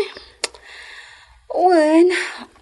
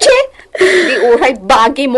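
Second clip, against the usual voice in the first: laughing; 8 dB louder than usual. Acted. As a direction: laugh through the words, a bit louder. Laughing back at a teasing friend.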